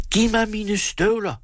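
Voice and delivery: high, drawn-out demand for boots; angry demand. A narrator's reading. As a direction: insist indignantly, pitched well up. Angry husband demands his boots.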